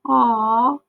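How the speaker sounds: big brother won over by his sister; tender aw. Acted. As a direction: melt at the baby's grip. Boy melts at baby.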